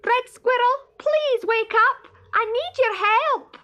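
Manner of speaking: high, loud call up the tree; shouting loudly. Acted. Stoat shouting up to sleeping Red Squirrel, loud and high. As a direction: yell to wake the squirrel. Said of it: "he shouted as loudly as he could".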